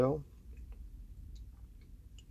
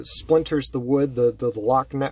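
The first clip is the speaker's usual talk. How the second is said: plain reading voice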